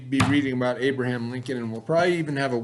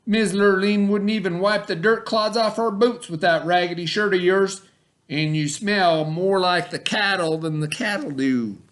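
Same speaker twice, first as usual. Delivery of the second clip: taunting stepbrother; 6 semitones higher, 4 dB louder than usual. A narrator's reading. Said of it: "said Milton".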